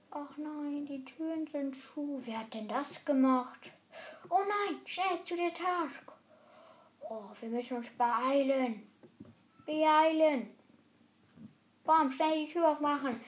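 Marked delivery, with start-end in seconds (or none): 4.3-13.2 higher, hammed-up panic; mock alarm. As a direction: fake the panic. Mock alarm about sabotage.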